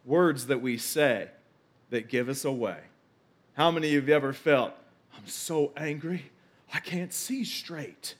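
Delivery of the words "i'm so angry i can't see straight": slow, hushed, held-in fury; mock quiet seething; seething anger. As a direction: draw it out quietly as if too angry to see. Voicing someone overcome by anger.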